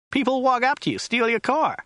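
high-pitched American driver; brash driver. Acted. American driver explaining carjacking to a Briton, brash high voice. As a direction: pitch it up, impatient American cabbie.

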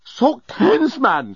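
high-pitched exasperated shout. A narrator's reading. Journalist shouting at the babbling old genius, exasperated shout. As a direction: start high, drop on the last word. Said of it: "shouted the journalist".

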